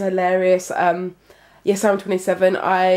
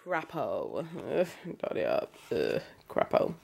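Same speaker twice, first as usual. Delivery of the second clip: silly groan; 6 semitones lower, 13 dB quieter than usual. Real, not acted. Groaning about messy room.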